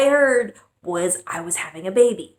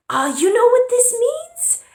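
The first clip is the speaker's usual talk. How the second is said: much higher, louder mock-mom question; imitating her mom; 5 dB louder than usual. Mom about to explain periods, high dramatic imitation. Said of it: "And she's like".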